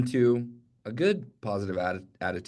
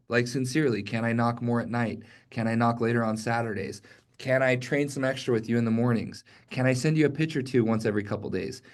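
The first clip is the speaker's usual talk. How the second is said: ordinary talk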